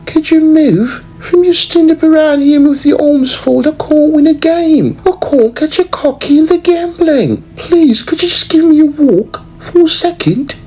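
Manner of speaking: high, put-on fancy lady voice; prissy high-pitched socialite; fussy rich lady. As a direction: play the demanding lady ordering her maid. Lady voiced talking to her help.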